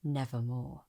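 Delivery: low, soft, otherworldly raven voice; the raven answering in a low hush; raven's reply. A narrator's reading. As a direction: drop into the bird's low, quiet croak. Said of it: "Quoth the raven".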